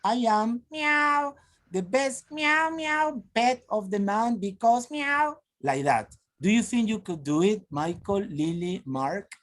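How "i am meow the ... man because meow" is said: high, slow meowing cat voice; teacher's cat voice. Shown as a demonstration. Teacher demonstrating to the class how to talk like a cat. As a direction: play a cat begging to be the best pet. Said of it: "For example, you will say".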